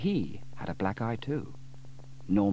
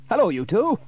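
higher, louder friendly greeting; cheery Sam; 12 semitones higher, 9 dB louder than usual. A narrator's reading. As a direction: welcome the children.

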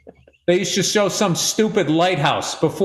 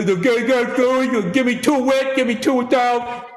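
lightly raised, camp mock customer; mock Philly order voice; 4 semitones higher than usual. Mocking how Philly locals order cheesesteaks, mock voice. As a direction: rattle off the order like a regular.

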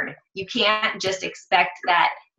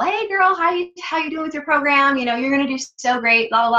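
much higher, bubbly mock-sweet girl voice; gushing cheerleader voice; 6 semitones higher than usual. Mocking the stranger cheering her cousin, in a much higher gushing voice. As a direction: parody a fake-friendly online cheerleader.

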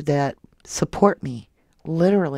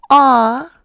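touched aw; 9 semitones higher, 11 dB louder than usual. Real, not acted. Touched by a gift.

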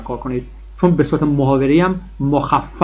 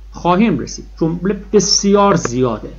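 ordinary talk

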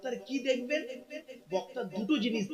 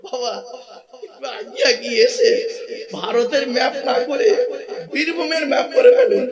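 laughing call; 5 semitones higher, 11 dB louder than usual. Real, not acted. Joking call to the crowd.

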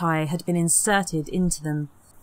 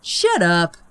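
louder sneering snap; 5 dB louder than usual. Acted. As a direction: cut her off with contempt. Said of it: "Peter sneered".

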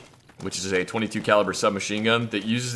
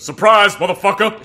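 loud, higher mock yell of the line; bellowing it like the TV character; Dexter character quote; 7 semitones higher, 6 dB louder than usual. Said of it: "one of the characters walks out and surprises Dexter and goes".

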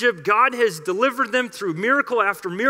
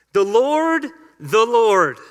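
proclaiming; 5 dB louder, slower than usual. Acted. The Lord passing before Moses, proclaimed loudly. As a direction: ring it out louder, rising on Lord.

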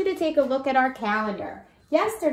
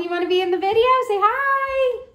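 put-on much higher, coaxing a small child; 11 semitones higher, 4 dB louder, faster than usual. Teacher coaxes little Nolan to greet the camera, high. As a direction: lift the voice way up to invite him.

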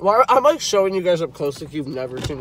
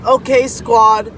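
hyped greeting; 7 semitones higher, 13 dB louder, slower than usual. Real, not acted. Vlog intro call to viewers.

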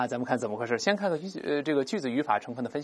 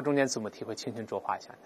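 ordinary talk, nothing marked

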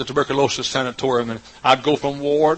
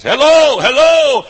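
loud, much higher hollered hello; calling out; 14 semitones higher, 12 dB louder, slower than usual. Retold or imitated. Preacher calling at a country church door, hollering. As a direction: holler to raise someone at the door. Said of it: "that's ringing the doorbell down in East Texas".

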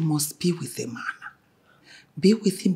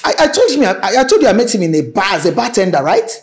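exasperated outburst; 3 semitones higher, 9 dB louder than usual. Acted. Mother objects that the boyfriend is a bartender, exasperated. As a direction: raise the voice in exasperation.

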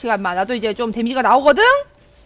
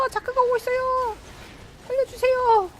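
mock plea; 10 semitones higher, 5 dB quieter than usual. 자크 접근에 애원.

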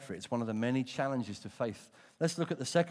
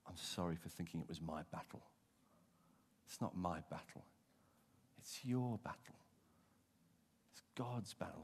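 much softer, low confession; humble prayer; 5 semitones lower, 9 dB quieter than usual. Pretend. A believer yielding the battle to God, soft humble prayer. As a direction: pray quietly and humbly.

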